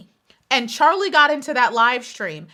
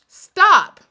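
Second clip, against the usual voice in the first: much higher, louder Stop; 7 semitones higher, 9 dB louder than usual. Real, not acted. To critics whining online, loud high Stop. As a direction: cut them off hard.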